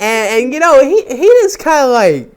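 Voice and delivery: high, loud, stammering disbelief; worked-up, squeaky struggle for words; flustered. Real, not acted. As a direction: blurt it out high and loud, stumbling. Flustered describing the scene.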